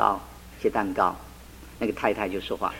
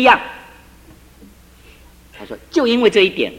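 fervent testimony; 4 semitones higher, 5 dB louder than usual. Retold or imitated. Husband's public testimony.

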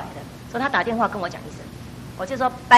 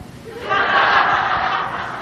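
high burst of laughter; 11 semitones higher than usual. Real, not acted. Laughing at the train story.

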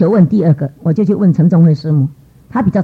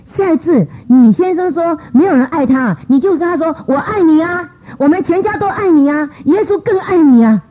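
earnest urging; 9 semitones higher than usual. Retold or imitated. Coaching sister what to say.

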